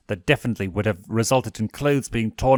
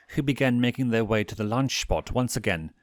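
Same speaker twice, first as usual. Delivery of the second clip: plain reading voice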